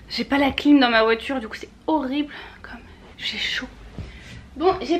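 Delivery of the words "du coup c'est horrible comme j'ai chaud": breathy whispered complaint; a worn-out whisper about the heat. Real, not acted.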